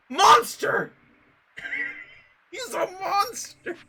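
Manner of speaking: high, hammed-up outrage at himself; mock outrage. Streamer mockingly echoing the game's accusation, hammed-up high outrage. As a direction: yell it like a shocked mob, laughing.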